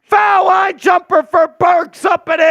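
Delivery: loud high play-by-play call; excited shout. Real, not acted. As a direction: shout it like a clutch bucket. Big shot call.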